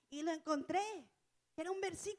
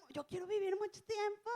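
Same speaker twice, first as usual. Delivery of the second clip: 1 imitating a hopeful believer; 4 semitones higher than usual. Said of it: "Que dicen uno".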